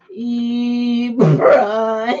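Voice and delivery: Ibrahim stretched out slowly, syllable by syllable; drawn-out name. Retold or imitated. Trying to pronounce a name, slowly stretched out. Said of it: "I'm like".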